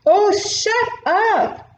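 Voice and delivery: high, slow, snappish little warthog voice; snapping warthog. Acted. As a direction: snap at the lion in a tiny high critter voice. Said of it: "snapped a little warthog".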